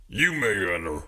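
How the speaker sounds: lightly voiced gruff doorman; a hint of a bouncer letting him in. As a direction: doorman grants entry. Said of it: "The gruff voice".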